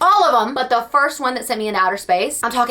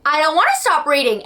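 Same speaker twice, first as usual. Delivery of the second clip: higher, whining protest; whiny; 6 semitones higher than usual. Retold or imitated. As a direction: whine it like a kid refusing to stop. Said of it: "So I'm like".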